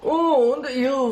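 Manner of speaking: put-on German accent. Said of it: "fake German accent".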